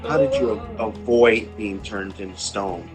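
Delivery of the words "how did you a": normal talking voice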